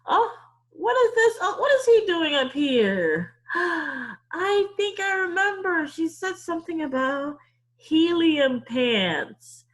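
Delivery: hammed-up astonishment for children; amazed. Teacher acts amazed at picture.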